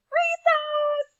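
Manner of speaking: high, loud shout of "Recess!"; joyful schoolkid yell; excited yell. A narrator's reading. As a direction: shout it like a kid at the bell. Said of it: "Aubrey yelled".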